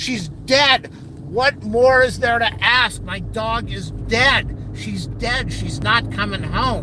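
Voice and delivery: grieving outburst at pointless questions; upset retort, pushing the words out; distraught exasperation. Retold or imitated. Retold distraught retort about dead dog.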